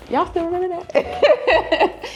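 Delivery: mock-amazed giggle; laughing disbelief. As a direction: marvel that they still recall. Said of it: "And I was like".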